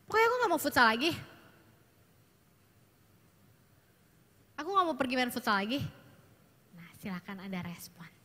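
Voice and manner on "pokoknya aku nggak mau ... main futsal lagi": fast, insistent refusal; lightly acted sulky boy; stubborn teenage son. Shown as a demonstration. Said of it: "dia ngomong begitu". (remaja laki-laki ngambek tidak mau futsal)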